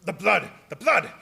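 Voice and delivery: the count seizing on the blood on Harker's neck; hungry fixation. Acted. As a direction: repeat it with barely held greed.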